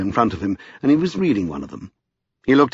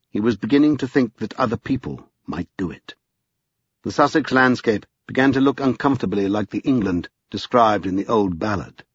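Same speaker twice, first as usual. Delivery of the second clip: plain reading voice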